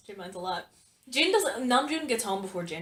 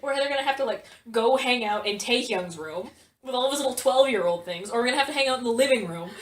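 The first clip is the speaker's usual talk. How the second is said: peeved rant; 2 semitones higher, 8 dB louder than usual. Retold or imitated. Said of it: "he's like, so peeved".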